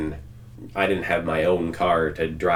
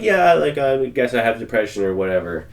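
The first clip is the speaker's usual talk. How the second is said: higher, louder start sliding down, retold brush-off; offhand, in passing; 5 semitones higher, 4 dB louder than usual. Admitting depression in passing to others, offhand and dismissive. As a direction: retell a casual, dismissive admission. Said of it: "in passing, been like".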